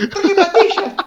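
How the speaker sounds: put-on high, laughing child's voice; little kid voice. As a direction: squeak it out like little Forti. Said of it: "me imagino a Forti chiquito".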